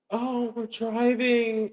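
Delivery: high, slow, put-on sweet voice; mock sweet wife. Mocking how a loving wife would talk, high and sweet.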